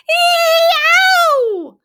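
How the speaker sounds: very high, loud yelp; wolf lands in the boiling turnip pot and screams; wolf scream of pain. A narrator's reading. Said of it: "with a scream".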